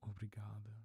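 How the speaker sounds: ordinary talk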